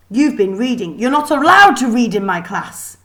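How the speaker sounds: put-on teacher scolding the reading girl. A narrator's reading. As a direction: lay down the law. Kraken scolding Lily.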